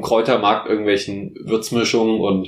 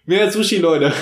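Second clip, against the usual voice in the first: rallying cry; 7 semitones higher, 3 dB louder than usual. Real, not acted. Rallying listeners for sushi.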